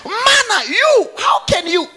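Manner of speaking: high, loud preaching, acting out the neighbours; preaching at full force. Pastor shouting the manna story to the church. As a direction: belt it out to the congregation.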